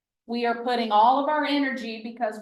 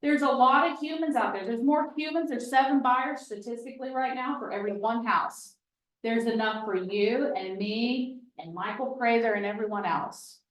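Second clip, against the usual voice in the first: ordinary talk, nothing marked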